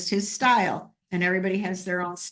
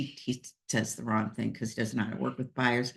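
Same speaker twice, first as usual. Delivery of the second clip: teasing aside; 6 semitones lower, 7 dB quieter than usual. Real, not acted. Teasing jab at absent colleague.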